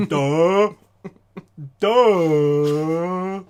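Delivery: dopey grunt. Retold or imitated. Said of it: "some fucking Titanic survivor".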